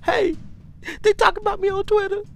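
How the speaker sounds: high-pitched, loud, fast cartoon otter; otter on the phone. Otter calling up about Twitter, high squeaky voice. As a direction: squeal it out like an excited little otter caller. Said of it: "Did that otter call you up and say".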